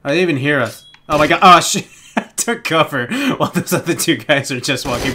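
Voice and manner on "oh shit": ordinary talk, nothing marked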